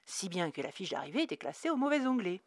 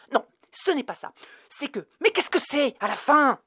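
loud, higher, exasperated; out of patience; 5 semitones higher, 5 dB louder than usual. Acted. Dana at the end of her patience, exploding. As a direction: burst out, fed up. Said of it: "éructa Dana au bout de son impatience".

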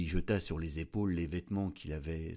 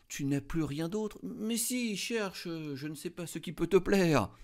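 much higher, eager begging voice; pleading; 11 semitones higher, 3 dB louder than usual. A narrator's reading. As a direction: coax her friend to look further. Mathilde begs her friend.

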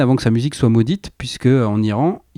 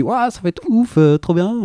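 mock wow; 6 semitones higher than usual. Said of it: "la pochette, tu dis".

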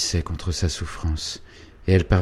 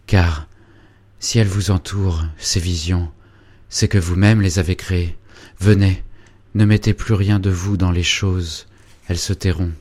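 put-on ardent young man, louder, pressing hard; urgent lover; 6 dB louder than usual. Félicien urging Angélique to leave her dreams, loud and fervent. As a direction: urge her to come away with you.

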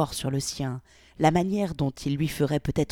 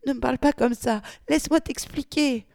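much higher, panicked begging; near panic; 9 semitones higher, 3 dB louder than usual. Acted. Victoria begs Polly on the brink of panic. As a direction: beg on the edge of panic. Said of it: "au bord de la panique".

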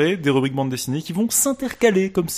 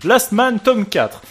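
mock indignation; 7 semitones higher, 6 dB louder than usual.

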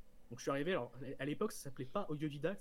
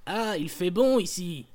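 booming, slow dwarf bellow; a loud, slow burly dwarf; 2 semitones higher, 14 dB louder, slower than usual. Acted. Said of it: "cher maître nain".

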